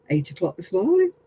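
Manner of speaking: ordinary delivery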